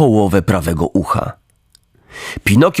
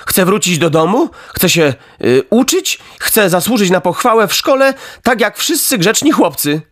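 put-on higher, firm boyish voice; raised, resolute puppet voice; earnest puppet Pinokio; 8 semitones higher than usual. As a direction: stand your ground like a good boy.

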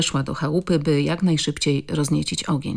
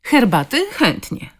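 eager agreement; 7 semitones higher, 3 dB louder than usual. A narrator's reading. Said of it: "potwierdził ochoczo".

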